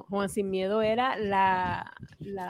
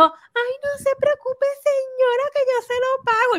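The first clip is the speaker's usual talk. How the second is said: much higher, syrupy lady voice; high sweet neighbor voice. Retold or imitated.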